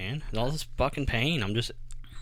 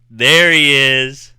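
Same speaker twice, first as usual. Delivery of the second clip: slow, drawn-out, much louder call; loud greeting; 3 semitones higher, 15 dB louder, much slower than usual. Retold or imitated. A gas station clerk loudly greeting a regular, drawn out. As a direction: call it out long and loud across the store. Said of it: "this girl goes".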